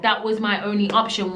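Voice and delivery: ordinary delivery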